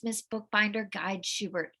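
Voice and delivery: plain reading voice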